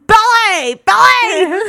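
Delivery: put-on high, loud shout in broad Belfast accent. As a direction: imitate someone yelling in a thick Belfast accent. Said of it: "a really Belfast accent shouting".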